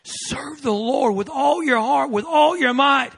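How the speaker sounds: high and loud, slow commanding call; voice he kept hearing. Retold or imitated. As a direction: proclaim it like a voice from heaven. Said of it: "All I could hear is".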